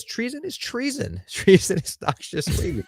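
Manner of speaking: high, slow laughing proclamation; pompous quote voice. Acted. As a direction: overblown royal decree through laughter.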